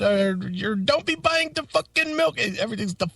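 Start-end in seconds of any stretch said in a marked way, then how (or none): 0.8-2.4 higher, scolding mock Indian accent. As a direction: ape the owner's Indian accent mid-rant. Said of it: "he's got that horrible accent".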